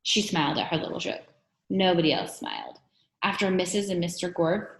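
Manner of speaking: ordinary delivery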